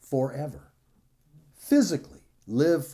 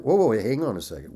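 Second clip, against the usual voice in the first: ordinary talk, nothing marked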